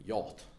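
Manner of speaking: Yacht said softly as "yawt"; trying out pronunciation. Shown as a demonstration. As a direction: quietly test Yacht with an "aw" vowel. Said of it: "How do you pronounce that?".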